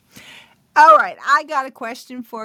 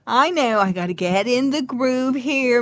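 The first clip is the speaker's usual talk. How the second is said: ordinary talk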